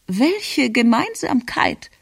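high, slow query; baffled grandmother. A narrator's reading. Grandmother queries the petition's wording, baffled and high. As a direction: question it in a high old-lady voice.

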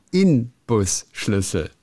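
Inbusschlüssel slowly, with a clear N; correct N version. Shown as a demonstration. Podcaster spells out the correct tool name slowly, clear N. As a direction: slowly separate In-bus in Inbusschlüssel. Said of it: "mit einem N".

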